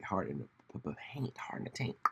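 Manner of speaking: unvoiced whispered aside. Real, not acted. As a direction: drop to a whisper.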